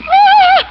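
sudden high shriek of fright; startled scream. Acted. Woman alone on ravine steps, terrified shriek. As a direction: scream as the shadow moves.

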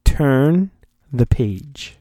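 usual speaking voice

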